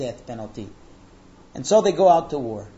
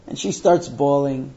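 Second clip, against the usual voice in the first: normal talking voice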